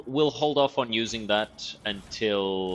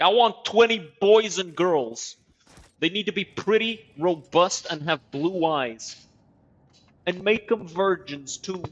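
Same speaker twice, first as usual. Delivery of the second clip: higher, louder, slow entitled demand; sneering parody of a spoiled ruler; mocking the governor; 8 semitones higher, 6 dB louder than usual. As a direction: ham up a greedy tyrant listing his wants.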